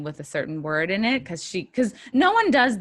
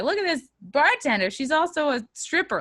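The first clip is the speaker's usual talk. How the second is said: high-pitched mock showing-off; sarcastic, lifted sightseeing patter; mock tour guide; 9 semitones higher than usual. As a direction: present her like a tourist attraction. Mocking tour of town, acted.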